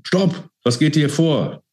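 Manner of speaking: very high-pitched alarmed cry; urgent high shout, slowing on the question; bellowed order. A narrator's reading. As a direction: burst in and halt them. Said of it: "riss die Türe auf und brüllte".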